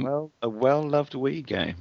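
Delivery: slow, teasing repeat; mocking echo. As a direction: drawl it back teasingly. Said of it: "repeats it mockingly".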